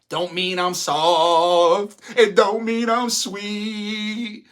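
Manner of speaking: slow emphatic. Real, not acted. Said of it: "stressing toughness".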